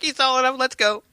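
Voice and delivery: high, sing-song mocking voice; mock-cheerful parody of husband; mock eager dad.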